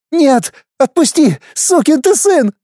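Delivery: furious woman. Acted. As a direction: spit the curse at him. Wife resisting, cursing. (яростный женский голос)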